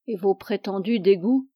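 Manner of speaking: ordinary delivery, nothing marked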